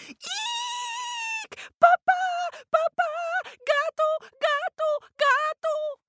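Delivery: very high, loud squealing mouse panic; shrieking mama mouse. Acted. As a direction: shriek for papa in terror. Mama mouse screaming for papa.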